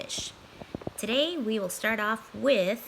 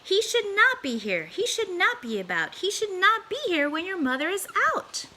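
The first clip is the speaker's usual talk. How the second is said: fretful fish; 10 semitones higher than usual. Acted. Fish begging the children to send the cat away, high and fretful. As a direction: fuss and scold like a nervous pet fish. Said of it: "our fish said".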